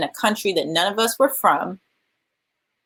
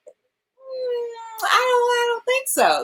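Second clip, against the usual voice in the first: high, hesitant mock reluctance; doubtful refusal; much slower than usual. Acting out university's doubtful refusal.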